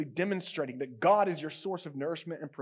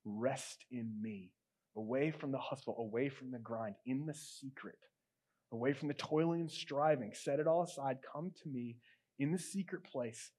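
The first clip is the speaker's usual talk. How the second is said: gentle father; 3 semitones lower, 8 dB quieter than usual. Acted. God calling a tired child to rest, gentle and soft. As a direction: lower and hush it as a loving invitation. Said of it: "He says gently".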